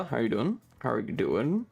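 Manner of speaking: ordinary talk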